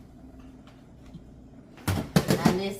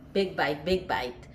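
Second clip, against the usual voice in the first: normal talking voice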